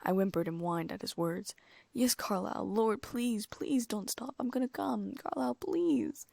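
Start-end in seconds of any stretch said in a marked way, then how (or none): 1.9-6.3 pleading. A narrator's reading. Climax pleading.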